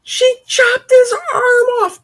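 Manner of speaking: very high hammed-up squeal of shock; mock shock. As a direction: shriek it like a horrified fan.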